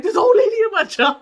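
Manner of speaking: loud, high outraged tattling; retold wailing complaint. Daughter telling mom about coworker, loud high wail.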